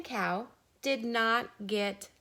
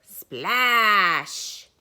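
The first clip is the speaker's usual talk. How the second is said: big splash; 11 dB louder than usual. Acted.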